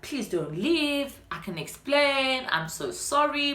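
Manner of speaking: slow, pleading mock apology; imitated begging. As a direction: drag it out like a guilty boyfriend. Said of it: "this man is begging me".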